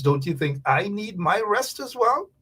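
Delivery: ordinary talk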